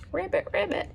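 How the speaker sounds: high playful ribbit; mock frog call for the children; frog croak. Imitates frogs heard at night.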